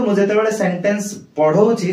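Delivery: ordinary talk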